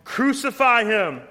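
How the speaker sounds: high, loud furious shout; mob shout. Acted. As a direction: roar it like the crowd gone wild. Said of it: "they shouted out all the more".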